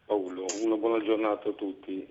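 congested voice from a cold; stuffy nose. Real, not acted. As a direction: speak through a stuffy nose. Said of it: "scusate la voce".

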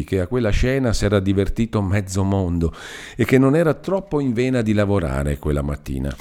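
ordinary delivery

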